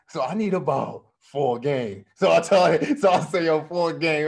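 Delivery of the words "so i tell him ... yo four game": excited. Real, not acted. Excited retelling to teammate.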